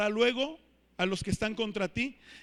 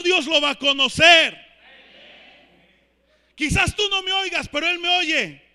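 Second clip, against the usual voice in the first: higher-pitched, driving sermon delivery; impassioned preaching; 5 semitones higher, 5 dB louder than usual. Real, not acted. Preacher assuring the congregation that God will hear, impassioned. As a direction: build to an emphatic proclamation.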